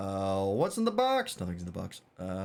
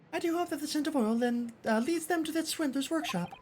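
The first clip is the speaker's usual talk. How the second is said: put-on much higher, polite young assistant; high girlish assistant voice. Assistant hoping the scent leads to the swindler, high and polite. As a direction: lift into a prim young lady's voice.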